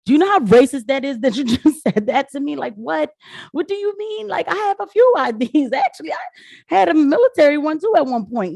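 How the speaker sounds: exasperated. Real, not acted. Heated rant about IDs.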